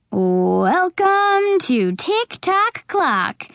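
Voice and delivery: slow, drawn-out showman welcome; game show host. A narrator's reading. Host greeting the studio audience, slow and showy. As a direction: stretch it out like a TV host.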